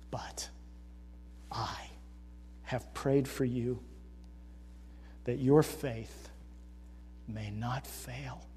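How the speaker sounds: slow, soft words of Jesus; gentle reassurance. Acted. Jesus reassuring Peter, slow and tender. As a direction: lay each word down tenderly.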